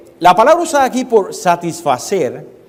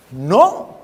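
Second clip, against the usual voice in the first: normal talking voice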